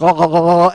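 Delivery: loud, rapid bounced-about ohs; mock jolted cries, punched out loud; jostled "oh oh oh oh". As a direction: imitate someone being shaken up and down, loud. Imitates woman bouncing while holding on.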